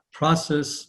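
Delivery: ordinary talk, nothing marked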